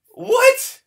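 high, loud shocked what; disbelieving yelp. Real, not acted. Renewed disbelief.

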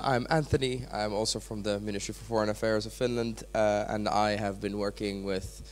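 ordinary talk, nothing marked

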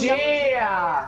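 high call with a long falling end; richiamo ad alta voce. Real, not acted. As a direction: call the name out high, letting it fall. Chiama un compagno di gioco. (richiamo acuto e prolungato)

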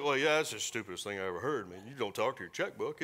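lightly voiced scoffing skeptic; gruff skeptic. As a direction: sneer it out like a know-it-all.

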